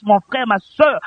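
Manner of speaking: ordinary talk, nothing marked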